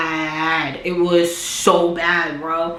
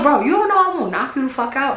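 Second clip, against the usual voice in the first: retold threat; 7 semitones higher, faster than usual. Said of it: "we getting a little loud now".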